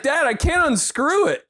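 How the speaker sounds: put-on high, whining child voice; whiny kid. Kid whining to dad about the stuck screw. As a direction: plead like a helpless kid. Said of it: "So I'd be like".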